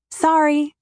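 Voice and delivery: high, loud, bright call-out; yelled apology. A narrator's reading. Calling out sorry to the honking driver, cheerful yell. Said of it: "I yell".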